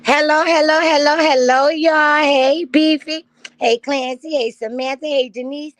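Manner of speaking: high, loud hype hello to the crowd; excited loud greeting. Real, not acted. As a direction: shout the hellos up high like a party host. Greeting the stream crowd on birthday.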